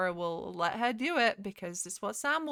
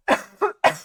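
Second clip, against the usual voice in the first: harsh dry cough from a dusty throat; coughing on dust; 7 semitones higher, 13 dB louder than usual. Real, not acted. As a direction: hack out a loud dusty cough. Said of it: "I got dust in my throat".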